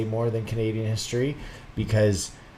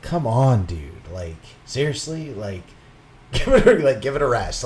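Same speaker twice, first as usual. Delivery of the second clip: exasperated; 4 semitones higher, 6 dB louder than usual. Retold or imitated. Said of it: "I'm like".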